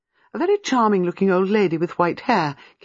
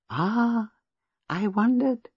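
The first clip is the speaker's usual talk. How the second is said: lightly voiced old lady; 7 semitones higher, much slower than usual. A narrator's reading. Old lady's vague reply.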